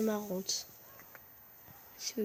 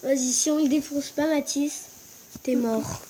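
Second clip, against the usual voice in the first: excited; 3 semitones higher, 7 dB louder than usual. Real, not acted. Threatening teammate excitedly.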